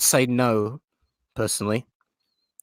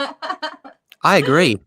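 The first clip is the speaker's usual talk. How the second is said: mock agreement; 6 semitones higher, 4 dB louder, much slower than usual. Exaggerated drawn-out agreement.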